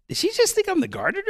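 high, fast amused voice of God. Pretend. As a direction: play God tickled by the mix-up, ending in a laugh. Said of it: "he's like".

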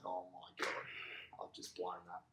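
slow, hushed, deflated whisper; dismayed whisper. Retold or imitated. Batsman just caught out, slow whispered despair. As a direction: breathe out the realisation slowly.

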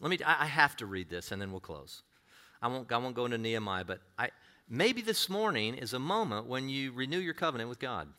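ordinary delivery

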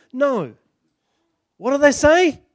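ordinary talk

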